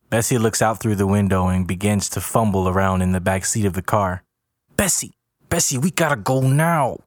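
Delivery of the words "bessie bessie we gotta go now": high, urgent plea; pitched-up alarm; panicked urging. A narrator's reading. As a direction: press your partner to flee. Urging partner to flee.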